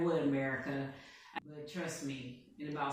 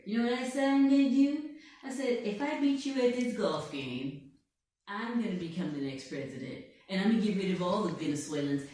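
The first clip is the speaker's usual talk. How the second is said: boastful blustering tycoon voice, put on all through; Donald Trump. President boasting to an interviewer, put-on blustering voice. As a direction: bluff and brag like a showman president. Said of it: "I'm Donald Trump".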